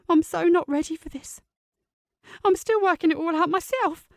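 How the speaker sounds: put-on young niece, hurt and tense; teenage girl voice. Teen niece Sophie voiced.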